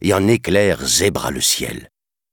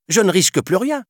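high, loud, fast hopeful question; eager boy; 4 dB louder, faster than usual. Acted. Mowgli asking to stay in the jungle, high excited burst. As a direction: burst out with joy.

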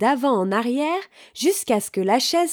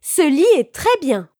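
higher, louder, pleased exclamation; exclaiming; 5 semitones higher, 5 dB louder than usual. A narrator's reading. Goldilocks lying on the third bed, exclaiming. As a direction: exclaim with loud approval.

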